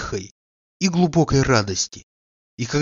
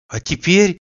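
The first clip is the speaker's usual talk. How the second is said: cheerful; 6 semitones higher, 4 dB louder than usual. A narrator's reading. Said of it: "весело заключил он".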